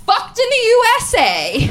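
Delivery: high, loud belted phrase; all-caps shout. Acted. Reading out an autocorrected text to roommate, all-caps bellow. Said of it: "all caps lock, one word".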